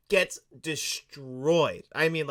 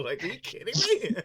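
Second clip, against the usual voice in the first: normal talking voice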